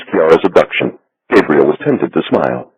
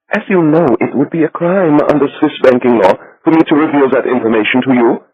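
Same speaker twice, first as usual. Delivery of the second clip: higher, fussy voice for the banker; little Swiss banker; 9 semitones higher than usual. A narrator's reading. Becker refuses Gabriel.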